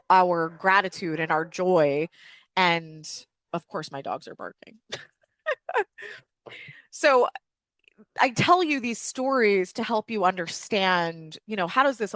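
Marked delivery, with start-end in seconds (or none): 5.4-6.1 short real laugh. Real, not acted. Laughs at barking dogs.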